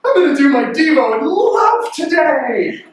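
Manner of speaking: over-eager morning person; high, gushing with a sharp gasp; gushing cheerful. Retold or imitated. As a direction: gasp then high, bright gush. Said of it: "you going".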